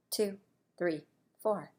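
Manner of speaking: ordinary talk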